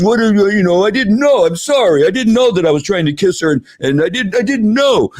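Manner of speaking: put-on high, pleading comic Italian accent; mock Italian accent. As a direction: protest innocence in a hammed-up Italian accent. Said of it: "that's my Italian accent".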